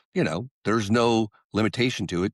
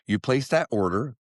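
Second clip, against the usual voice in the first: ordinary talk, nothing marked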